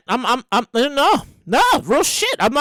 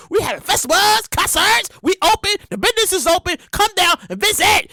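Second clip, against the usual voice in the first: higher-pitched, hammed-up sales pitch; eager state promoter; 4 semitones higher than usual. Reopened state inviting visitors, hammed-up eager welcome. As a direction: pitch it like an eager local host. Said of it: "these two safe states that was like".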